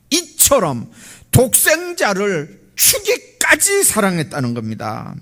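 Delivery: high, loud preaching with swelling key words; forceful preaching. Real, not acted. Preacher telling the congregation all are one in Christ, forceful preaching. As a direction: proclaim it from the pulpit, stretching the emphasis.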